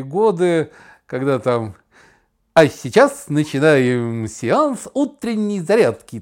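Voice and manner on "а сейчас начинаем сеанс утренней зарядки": slow, louder, hammed-up Soviet radio announcer; a lightly done cheerful 1950s radio host; brisk announcer voice. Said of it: "Бодрый дикторский голос". (бодрый дикторский голос, слегка пародийно)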